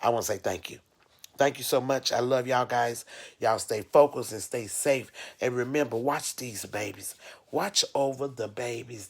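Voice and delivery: ordinary talk, nothing marked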